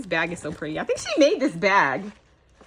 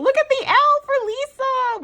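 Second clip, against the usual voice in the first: high and loud, thrilled; delighted squeal; 6 dB louder than usual. Real, not acted. Showing viewers the L charm, a high thrilled squeal. Spotting her initial charm.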